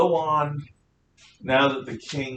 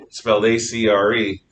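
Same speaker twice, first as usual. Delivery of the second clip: ordinary talk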